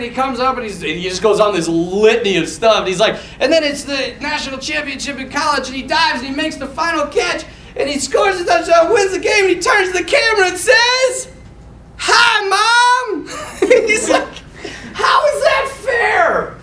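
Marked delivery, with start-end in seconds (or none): 3.4-11.3 high, breathless climb to a shout; building excitement. Retold or imitated. Comic retelling builds to punchline. 12.0-13.3 high, loud mock cheer to Mom; hammed-up victorious son; triumphant shout. 14.9-16.5 high, drawn-out comic wail; mock-aggrieved shout, slow and high; exasperated outcry. Exasperated father punchline.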